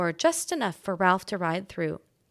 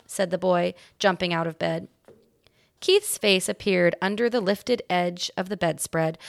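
usual speaking voice

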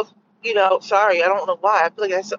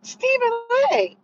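raised, incredulous mock scolding; high mock retort; 7 semitones higher than usual. To Stephen A, a high, incredulous mock scolding. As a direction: scold him like he lost his mind. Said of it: "I was like".

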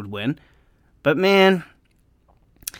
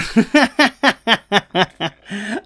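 bright rising laugh at his own slip; embarrassed laugh; 8 semitones higher, 4 dB louder than usual. Real, not acted. Fan laughing at himself after forgetting to mute, embarrassed laugh. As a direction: laugh it off, caught out. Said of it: "did I not mute again?".

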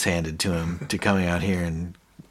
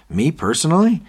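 high, louder incredulous question; taken aback; 10 semitones higher, 8 dB louder than usual. Retold or imitated. Gareth told he was slagged off, taken aback. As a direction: imitate someone stung and astonished.

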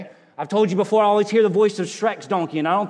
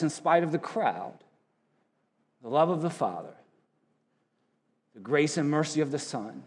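quietly preaching, sinking softer at each phrase ends; soft reflective preaching; 7 semitones lower, 8 dB quieter than usual. Acted. Preacher to congregation about the cross, softly reverent. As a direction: let the congregation lean in as you speak softly.

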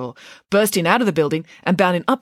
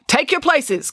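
high-pitched starting command; calling out; 11 semitones higher, slower than usual. Acted. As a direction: call the runners to the line. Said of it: "called the vice principal".

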